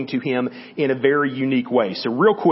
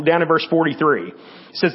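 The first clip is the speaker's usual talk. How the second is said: usual speaking voice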